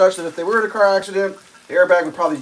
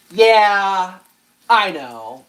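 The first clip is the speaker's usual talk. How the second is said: a hint of an unbothered driver; bored driver's reply; 3 semitones higher, 4 dB louder, much slower than usual. Driver brushing off the brake light warning, lightly unbothered. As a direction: shrug it off lightly. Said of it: "this female driver ... goes".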